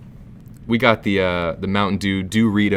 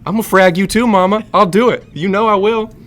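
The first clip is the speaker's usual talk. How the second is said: high, loud tantrum threat; bratty threatening kid; 4 semitones higher, 6 dB louder than usual. Pretend. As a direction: threaten Mama like a spoiled brat. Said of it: "Rich Southern gamer".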